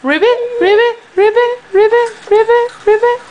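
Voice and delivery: high, bouncy ribbits; squeaky froggy croaks for baby. Pretend. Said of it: "A, a froggy?".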